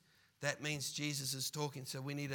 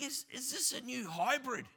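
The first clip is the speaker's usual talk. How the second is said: higher, amused puzzled question; mock puzzled wonder; 7 semitones higher, 4 dB louder than usual. Driver at the lights wondering at vape clouds, mock puzzled. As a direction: ask it in mock amazement. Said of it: "And you think".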